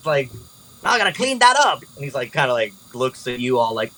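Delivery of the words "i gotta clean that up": high-pitched goblin complaint; grumbling goblin. Acted. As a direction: gripe as a put-upon little goblin cleaner. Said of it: "goblins that's, like, cleaning up".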